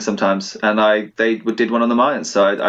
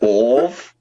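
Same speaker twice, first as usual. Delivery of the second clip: put-on Scottish accent. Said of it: "That's Scottish".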